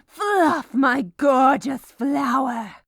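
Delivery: high, loud, swooning exclamation; gushing cry. Acted. Baker swooning over dough, high loud cry. Hammy ode to cookie dough.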